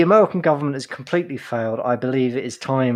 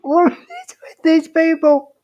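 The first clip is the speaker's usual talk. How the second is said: exasperated; 4 dB louder, slower than usual. Real, not acted. Exasperated co-host.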